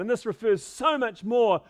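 ordinary delivery

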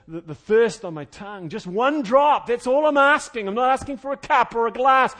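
acted plea, building to high, loud begging; rising, hammed-up cry for mercy; crying out in torment. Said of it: "he's crying out".

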